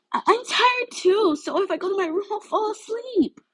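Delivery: pleading. Acted. Mina pleading to stay up.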